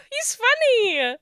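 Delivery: high, drawn-out gushing; adoring squeal. Real, not acted. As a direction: coo it high and slowly, delighted. Gushing over mascot.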